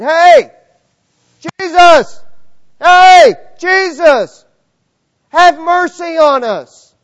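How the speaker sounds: desperate shouts from afar; calling out. Retold or imitated. Lepers shouting to Jesus from far off, desperate loud calls. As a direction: yell across the distance for mercy.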